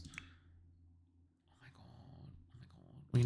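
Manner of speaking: breathed slow oh my God; exasperated whisper. Real, not acted. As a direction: whisper it in disbelief. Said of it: "He's probably tense".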